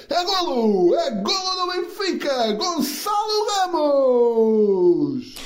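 stretched goal cry; fast dramatic football commentary. Real, not acted. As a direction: drag out the name. Repeated goal call.

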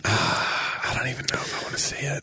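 real cringe whisper; embarrassed whisper. Real, not acted. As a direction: hush it, cringing. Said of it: "Oh, no".

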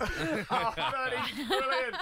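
slow, high, laughing reaction. Real, not acted. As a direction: laugh it out with delight. Host laughs at the Uber story.

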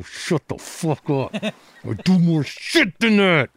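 put-upon star snapping back, slowly; mock angry retort. Retorting to people calling him lemon guy.

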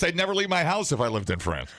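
usual speaking voice

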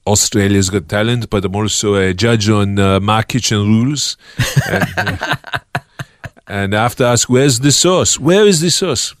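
put-on French-accented TV cooking judge; Manu. Cooking judge demanding the sauce, French-accented Manu impression. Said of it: "Manu here from".

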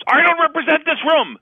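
squeaky high nervous voice; nervous high imitation. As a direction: pitch way up, anxious. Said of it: "and he goes".

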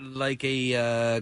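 ordinary talk

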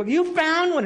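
high, loud thrilled echo; excited shout. Retold or imitated. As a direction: shout it back as the amazed wife. Wife excitedly echoes his find.

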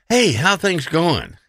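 high, slow, chipper hello; breezy fake-friendly greeting; cheery greeting. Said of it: "go up to and go".

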